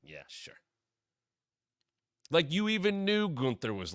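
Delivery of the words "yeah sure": dry disbelief. Retold or imitated. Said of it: "Bret's like".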